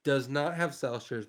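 normal talking voice

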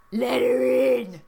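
loud, slow Trump holler; calling out. Acted. Trump calls to the bellhop at the suite door, calling out. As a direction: call through the door as a hammed-up Trump. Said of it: "he calls".

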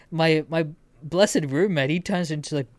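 normal talking voice

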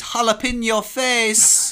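high, drawn-out comic shout; shouted punchline. Acted. Joke teller delivering the pepper punchline, shouted. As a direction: belt out the pun slowly, pitched right up.

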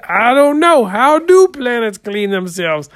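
high, loud puzzled audience reply. Shown as a demonstration. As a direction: play the clueless audience member. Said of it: "You say".

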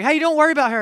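loud, high, dismissive snap; aggressive man. Retold or imitated. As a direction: brush off the stranger like a bully. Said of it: "And he's like".

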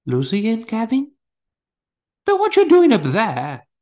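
high, loud, startled asking; surprised, calling up to them. Acted.

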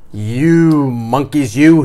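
loud, slow finger-wagging scolding; storybook peddler telling off monkeys, slowly. A narrator's reading. Peddler scolding thieving monkeys, loud and drawn out. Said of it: "he said, shaking a finger at them".

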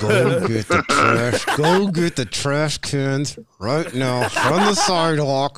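slow, drawn-out order with mouth barely moving; ventriloquist dad. Retold or imitated. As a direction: imitate a dad ordering chores through still lips. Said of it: "your mouth barely moved".

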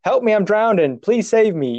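high-pitched mimed cry for help; mock drowning plea. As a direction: act out a plea for rescue without full panic. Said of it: "I couldn't even say".

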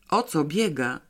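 plain reading voice

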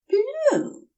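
high bright exclamation; triumphant. Acted. Aziraphale remembering the bedspread colour, triumphant high cry. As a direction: announce it like a win. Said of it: "with a sound of triumph".

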